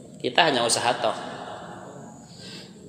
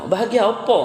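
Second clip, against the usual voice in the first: high curious question; curious pressing; 7 semitones higher than usual. Retold or imitated. Pressing friend. (bertanya penasaran dengan suara tinggi)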